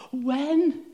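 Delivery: high, loud panicked "When?"; mock panicked God. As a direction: yelp "When?" in mock alarm. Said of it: "never heard him say".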